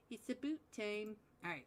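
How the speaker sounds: ordinary delivery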